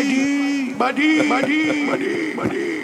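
very high repeats, fading at the end; imitated echo. Teasing Mike with the echo, high fading repeats. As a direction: repeat it like an echo bouncing away.

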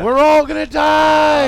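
high, loud, slow doom wail; mock panic yell. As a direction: wail it like the world ends.

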